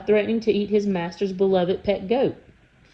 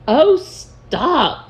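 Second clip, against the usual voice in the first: a higher, louder exasperated troll snap; 6 semitones higher, 6 dB louder than usual. Acted. Mard telling the trolls to stop, exasperated. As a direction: cut them off, fed up.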